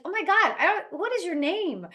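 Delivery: high, startled "oh my God" breaking off into a question; mock overwhelmed. [name] cornered by card pushers, mock overwhelmed. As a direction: act swamped by cards you never asked for. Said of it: "I'm like".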